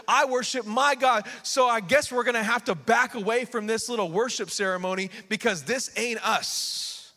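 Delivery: preacher voicing bold refusal; defiant believers. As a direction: stand your ground, slowing on the key refusals. Acts believers defying the king.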